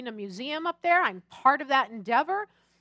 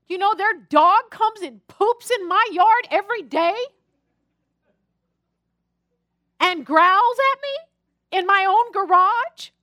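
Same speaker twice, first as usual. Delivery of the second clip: indignant protest; 5 semitones higher, 6 dB louder than usual. Retold or imitated. Protesting God's command to be nice.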